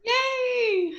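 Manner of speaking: a joyful squeal of a yay; excited cheer. Real, not acted. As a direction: cheer it out high and bright. Cheering on joining the call.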